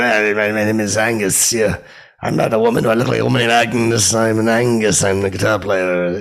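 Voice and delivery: loud, indignant little troll; trollish voice. Retold or imitated. Guitarist corrects a fan on his name, trollish indignant voice. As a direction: correct them like a peeved troll. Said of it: "that little weird trollish voice".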